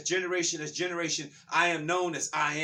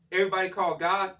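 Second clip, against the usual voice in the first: normal talking voice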